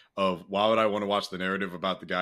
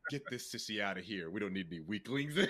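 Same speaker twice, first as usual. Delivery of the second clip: gruff character voice; 7 dB quieter than usual. Retold or imitated. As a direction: growl it out like a bullying general. Mimicking Oldman's movie character.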